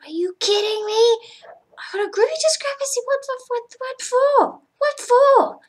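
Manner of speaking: high-pitched indignant disbelief; incredulous outrage. Retold or imitated. Two crew members reading their discrepancy notices, high-pitched incredulous outrage. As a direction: shoot the pitch right up, appalled.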